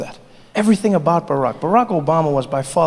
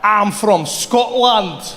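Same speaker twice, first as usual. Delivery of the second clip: slow, laughing bad Scottish accent; a tourist's poor Scottish accent cracking up; 4 semitones higher, much slower than usual. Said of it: "learn the Scottish accent".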